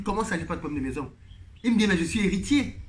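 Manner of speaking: ordinary talk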